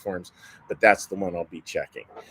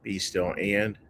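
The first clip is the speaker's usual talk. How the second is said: guided meditation; 3 dB quieter than usual. Acted. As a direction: let each line fall away into the silence. Leads a meditation.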